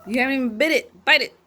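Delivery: loud, fast nagging; scolding. Real, not acted. Mom nags child to bite.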